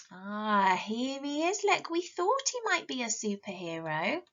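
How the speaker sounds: ordinary delivery, nothing marked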